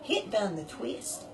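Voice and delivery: ordinary talk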